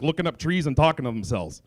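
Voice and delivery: normal talking voice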